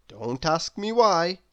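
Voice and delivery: ordinary delivery, nothing marked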